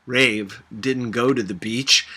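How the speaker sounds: plain reading voice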